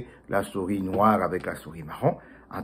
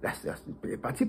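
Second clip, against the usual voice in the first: plain reading voice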